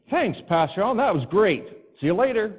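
put-on a high, bright kids' thank-you; cheery kids. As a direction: chirp it like children leaving class.

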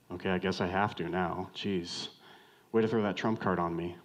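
low, deflated grumble; grudging acceptance. Acted. Wronged person cornered by a trump card, grudging. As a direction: give in reluctantly, pitched low. Grudging reply to someone short.

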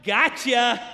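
loud, grinning mock shout; gleeful gotcha. Five-year-old son aiming at dad, gleeful gotcha. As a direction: imitate a delighted kid springing an ambush. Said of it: "smiling ear to ear like".

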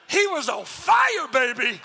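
loud, high and slow, joyful yell; excited shout. Retold or imitated. Pastor celebrating to Ralph that the new convert is on fire, excited shout. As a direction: cheer it out with laughter.

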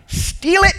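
steal it bursts out high and loud; sudden shout. Acted. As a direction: spit the words out in a yell. Enemy's threat peaks.

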